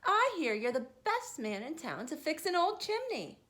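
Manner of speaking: plain reading voice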